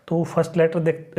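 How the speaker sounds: ordinary talk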